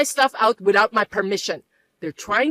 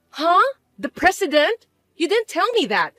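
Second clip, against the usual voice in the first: shocked surprise; 6 semitones higher than usual. Acted. Mother shocked by news.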